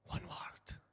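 emphatic whisper. Real, not acted. Preacher stressing the power of one word, whispered. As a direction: drop to a whisper to make it land.

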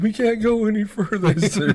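put-on high, giggly voice of stoned kids; laughing stoner reply. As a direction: squeak it out like a high teenager. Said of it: "And they're like".

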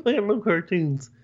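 high, laughing cartoon voice; squeaky giggling mock Kermit; Kermit impression. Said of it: "was like".

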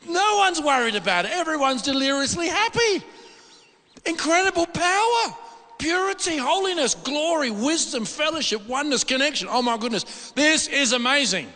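high, loud, rapturous list; ecstatic wonder. Real, not acted. Preacher telling the congregation about heaven, in ecstatic high praise. Describing glory of the vision.

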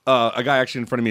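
normal talking voice

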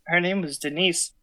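ordinary delivery

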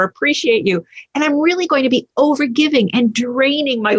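high-pitched, hammed-up eagerness; gushing. Codependent laying out the secret bargain, gushing.

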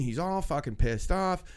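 normal talking voice

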